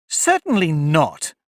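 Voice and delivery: indignant. A narrator's reading. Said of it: "said Alice indignantly".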